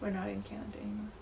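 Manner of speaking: quick, hushed whisper; whispered aside. Real, not acted. Wife corrects husband mid-video, whispered.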